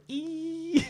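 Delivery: thin, very high sustained tone; imitated ear ringing. Hunter's ears after shooting, imitated high ringing. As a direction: hold a high whining ring. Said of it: "I just hear, like, you know".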